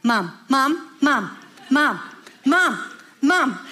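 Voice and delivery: put-on insistent kid's repeated calls; nagging child. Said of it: "when your child is saying".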